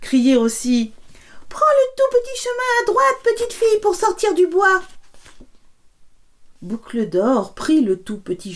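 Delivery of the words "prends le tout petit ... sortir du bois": very high whispered bear cub; very high tiny whispered call. Acted. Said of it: "de sa toute petite voix".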